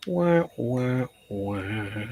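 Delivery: mock wah-wah of defeat; sad trombone. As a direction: sing the losing trombone. Mocking their own loss.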